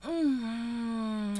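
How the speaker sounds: high, loud cry of pleasure; loud moan. Acted. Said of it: "moaning louder and louder".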